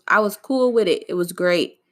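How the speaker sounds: ordinary talk, nothing marked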